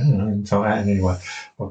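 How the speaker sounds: ordinary talk, nothing marked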